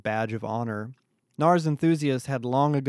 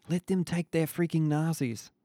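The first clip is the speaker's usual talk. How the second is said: higher, tense hiss; hissed through teeth; 4 semitones higher than usual. A narrator's reading. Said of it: "Jade hissed through his teeth".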